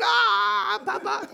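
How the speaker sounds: high, loud mock child shriek; imitating a child's scream. Preacher imitates his child grabbed by the neck, high mock shriek. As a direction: squeal like the startled little kid. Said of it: "rein und".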